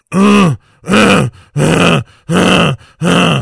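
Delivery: loud, high mock gator grunts; mating grunts. Hunter mimicking alligator mating sounds, loud grunts. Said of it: "And he goes".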